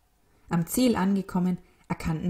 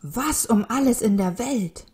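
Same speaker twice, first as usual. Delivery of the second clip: louder, higher exclamation; raised voice of amazement; astonished cry; 5 semitones higher, 5 dB louder than usual. A narrator's reading. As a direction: call out in bewilderment. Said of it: "rief ein Mensch".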